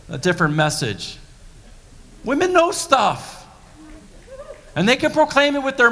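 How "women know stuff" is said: much higher and slow, each word weighted; slow sassy punchline; pointed emphasis. Real, not acted. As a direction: drive it home with sass. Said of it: "preacher's pointed aside".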